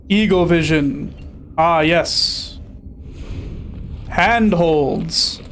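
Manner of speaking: normal talking voice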